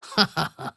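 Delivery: short menacing laugh; evil laugh. Acted. A villain laughing after a threat. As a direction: chuckle darkly.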